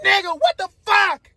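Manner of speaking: high-pitched exasperated yelp; retold incredulous outburst. Man replaying his shock at the confession, incredulous outburst. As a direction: replay the disbelief, half amused. Repeats his outraged reaction, laughing after.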